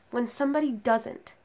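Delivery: emphatic recital, hitting key words hard; forceful slam-poetry delivery; impassioned spoken-word poem. Acted. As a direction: deliver each phrase as an indictment, pausing between. Poem builds to accusation.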